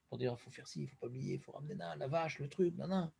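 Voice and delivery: softly muttering busy worries; mock worried mutter. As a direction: murmur the chores like a fretting farmer. Mimics worried farmers' thoughts. (marmonnement inquiet imité)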